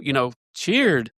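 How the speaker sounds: ordinary talk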